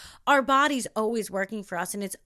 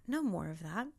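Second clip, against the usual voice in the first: echoed much softer and lower; No more of that. dropped low and soft; 4 semitones lower, 9 dB quieter than usual. Shown as a demonstration. Speaker to herself, soft low echo.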